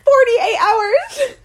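laughing outrage. Real, not acted. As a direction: stretch it out in mock despair. Outraged at Rodney's timeline.

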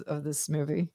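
usual speaking voice

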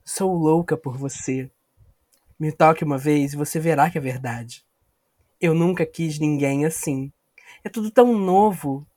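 breathy soap-opera lover lines; sultry romance read. Acted. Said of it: "esse não sou eu cantando pra Tainá".

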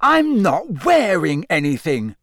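high, loud embarrassed protest; flustered indignant outcry; outraged. A narrator's reading. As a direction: yelp it in scandalised outrage. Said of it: "Harry said in outrage".

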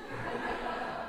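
airy soft chuckle; quiet breathy chuckle; breathy laugh. Real, not acted. Laughs at forgotten point.

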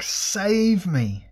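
high-pitched cry for rescue; screamed plea. A narrator's reading. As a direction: scream out, begging to be saved.

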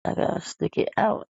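ordinary talk

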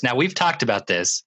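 ordinary delivery, nothing marked

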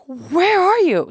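loud, slow, desperate where are you; demanding where she is, near tears; upset shout. Retold or imitated. Daughter shouting at her mom on Skype, upset.

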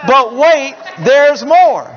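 loud, high pitchman shout; infomercial pitchman. Retold or imitated. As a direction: sell it hard like late-night TV. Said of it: "That's what they'd say".

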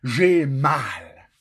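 hurting. Retold or imitated. Retelling his pain in the truck.

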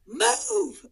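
high loud shout. Retold or imitated. Yelling at woman blocking bathroom, loud shout. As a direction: bark it at her.

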